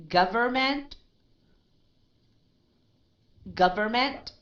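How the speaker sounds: "Government" said clearly, pitch lifted; careful model word. Shown as a demonstration. Teacher models "Government" for the student, lifted. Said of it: "read this word as without a T".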